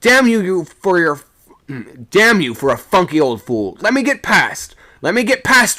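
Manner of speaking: very high, loud roar; a sailor roaring in fury at a shipmate; furious roar. A narrator's reading. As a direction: roar at him to move. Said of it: "I roared".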